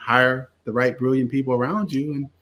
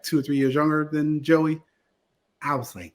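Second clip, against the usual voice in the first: ordinary talk, nothing marked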